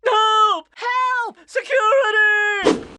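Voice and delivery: high, loud frantic yells; terrified mayor shouting for security; panicked cries for help. Acted. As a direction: scream for the guards. Mayor attacked, calling for help.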